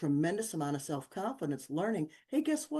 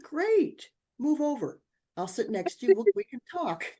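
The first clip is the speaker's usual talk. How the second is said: high, louder eager chatter; eager invitation; 8 semitones higher, 5 dB louder than usual. Retold or imitated. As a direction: push in cheerfully beside him. Bold self-invite, quoted.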